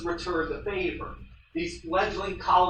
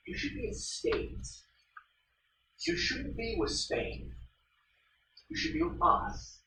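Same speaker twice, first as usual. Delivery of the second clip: voicing the US; 10 semitones higher, 7 dB quieter than usual. US voiced wooing Cuba.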